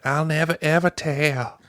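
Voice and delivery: mock Southern girl's solemn promise; thick country accent. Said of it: "That accent, man".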